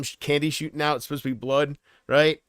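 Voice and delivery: ordinary talk, nothing marked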